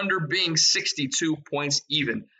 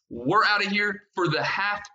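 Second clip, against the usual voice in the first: normal talking voice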